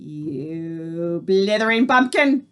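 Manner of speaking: Grand High Witch screeching at the foolish witch; screeched insult. A narrator's reading. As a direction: spit the insult with contempt. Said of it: "screeched the Grand High Witch".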